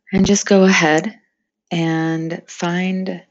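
unhurried meditation voice with long pauses; guided meditation. Acted. Meditation leader inviting friends to picture flowing water, calm and spacious. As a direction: guide them gently, phrase by phrase, leaving space.

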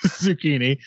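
Zucchini repeated with a laugh; laughing echo. Retold or imitated. Co-host laughing, echoing the Italian name. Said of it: "Should be Zucchini".

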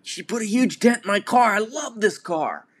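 mock the upset driver, overdone and shrill-pitched; angry stranger whining about his beloved car; irate car owner.

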